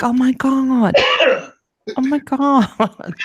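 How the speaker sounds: high, slow, drawn-out oh my God; exasperated oh my god. Retold or imitated. Retelling the coffee line to friends, high drawn-out oh my God. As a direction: stretch it out in disbelief. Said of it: "I'm like".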